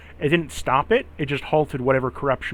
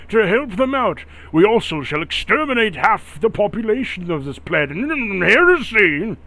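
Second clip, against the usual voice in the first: high, zealous Inquisitor voice; cold pious accusing; 6 semitones higher, 4 dB louder than usual. Acted. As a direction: condemn them like a zealous inquisitor. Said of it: "Doing his best Inquisitor impression".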